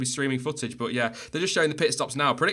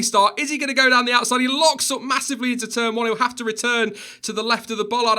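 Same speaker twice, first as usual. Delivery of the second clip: loud, high live play-by-play; excited race commentary; 9 semitones higher, 5 dB louder than usual. Real, not acted. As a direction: call the race start at full pitch.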